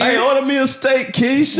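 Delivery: high loud teasing holler; calling from far away. Pretend. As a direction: shout it across the street. Teasing call across the street.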